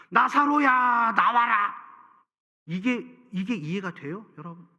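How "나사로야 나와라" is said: calling out. Acted. Jesus calls dead Lazarus out.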